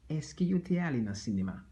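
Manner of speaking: 'Est-ce que yo te ale nan sinema?' rattled off fast at natural speed; quick repeat. Shown as a demonstration. Teacher repeats the question fast at native speed. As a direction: run through it quickly.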